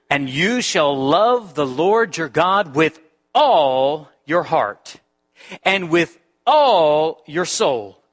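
every "all" slowed and leaned on hard; stress on all. Shown as a demonstration. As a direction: punch each "all" in the list, slowly. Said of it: "my very intentional, purposeful emphasis on the word all".

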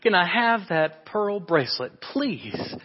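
put-on high, begging child's voice; little girl pleading. Jenny begs her mother for the pearls, high whining plea. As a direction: plead like a five-year-old wanting the pearls. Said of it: "she pleaded".

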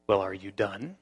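calm inner voice. Retold or imitated. God's voice in thought.

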